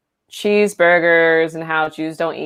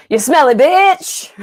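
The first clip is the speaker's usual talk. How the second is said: high, loud, drawn-out mock rapper yell; 10 semitones higher, 5 dB louder, slower than usual. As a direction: ham up a rapper's insult. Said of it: "a voiceover of [name] going".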